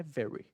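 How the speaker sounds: very with an untrilled English retroflex r; correct throaty R. Shown as a demonstration. Teacher correcting to the right form, throaty r in very. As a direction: say very with the Paulista porta r. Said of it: "Com esse R do".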